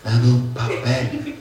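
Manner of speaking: very slow, soft, drawn-out request; imitated trembling patient. Trembling husband asking his wife for paper, weak and slow. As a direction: drag the words out softly from a sickbed. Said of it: "si está temblando todo".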